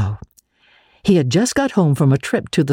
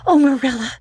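put-on much higher excited girl; breathless exclaim; 11 semitones higher, faster than usual. Anne rushing in to Marilla, breathless and much higher. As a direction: burst in breathless with news. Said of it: "she exclaimed breathlessly".

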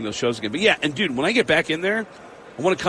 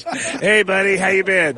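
Tom Arnold answering; 4 semitones higher, 4 dB louder than usual. Retold or imitated. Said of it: "Tom Arnold goes".

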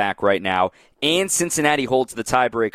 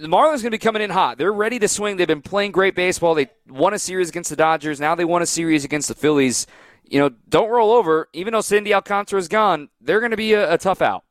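excited; 8 semitones higher than usual. Real, not acted. Hyping the Marlins' hot streak.

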